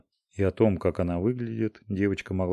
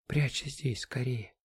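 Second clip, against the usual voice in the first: whispering while hiding; 5 semitones higher, 7 dB quieter, slower than usual. Acted. Hiding girl whispers urgently.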